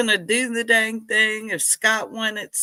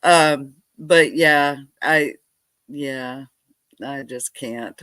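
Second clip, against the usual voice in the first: ordinary talk, nothing marked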